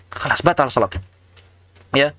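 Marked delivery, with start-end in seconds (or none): none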